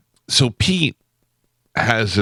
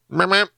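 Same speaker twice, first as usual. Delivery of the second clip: Pete's call; 10 semitones higher than usual. Retold or imitated. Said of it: "And Pete goes".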